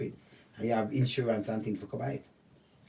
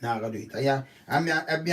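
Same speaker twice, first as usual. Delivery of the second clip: ordinary delivery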